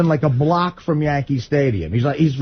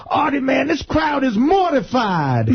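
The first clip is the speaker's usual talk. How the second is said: higher, gleeful mimicked voice; happy Tracy Morgan; 7 semitones higher, slower than usual. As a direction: delight in it with a high, drawn-out impression. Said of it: "he's got a real happy look".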